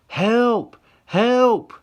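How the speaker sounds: very high, loud panicked scream; shrill desperate call; screaming for help. Acted. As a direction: scream down the street. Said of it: "she screams".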